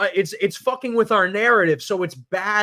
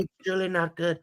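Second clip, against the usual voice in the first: Trump impression; 2 semitones lower, 6 dB quieter than usual. Trump repetition.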